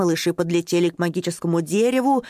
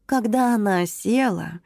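plain reading voice

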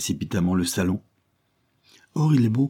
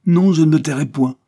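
higher, louder defiant refusal; 8 semitones higher, 8 dB louder than usual. Acted. Lady Levison refuses to be silenced, defiant. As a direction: fling the refusal back at him. Wife defies husband in quarrel.

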